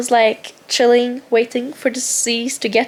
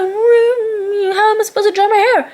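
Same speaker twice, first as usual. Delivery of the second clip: mocking the girl; 9 semitones higher than usual. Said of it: "I was like".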